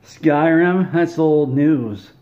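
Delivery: put-on loud, high scoff, slowing on old news; scoffing shout. [name] mocks her boyfriend's game, loud scoffing shout. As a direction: bellow it like the game is ancient history.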